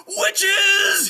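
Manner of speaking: very high, very loud horrified cry; horrified shriek. Acted. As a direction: shriek it long as the truth dawns. Squire finds bones, realizes danger.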